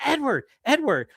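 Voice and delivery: high, urgent repeat of the name; excited teen coworker insisting. Retold or imitated. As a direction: press the name eagerly.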